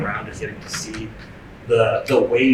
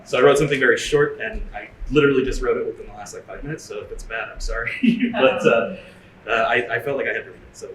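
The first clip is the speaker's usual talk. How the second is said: usual speaking voice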